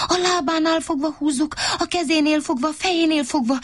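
high, excited shrieks; shrieking. Acted. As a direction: shriek the ideas over each other. (magas, izgatott rikoltozás)

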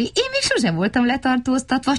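small high eager voice; delighted little creature; lightly voiced little fellow. A narrator's reading. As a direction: brag about it like a thrilled little kid. Vacska Matti voiced.

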